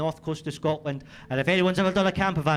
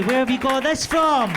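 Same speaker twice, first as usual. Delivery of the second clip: panicked question; 7 semitones higher, 7 dB louder than usual. Retold or imitated. Retold panicked reaction to test.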